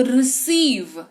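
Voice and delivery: रिसीव with the "ee" held long; lingering long ee. Shown as a demonstration. Teacher showing the vowel lingers, long held "ee". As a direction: stretch the second vowel of रिसीव, "ri-seeev". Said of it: "यहां पर देखो ठहराव ज्यादा है".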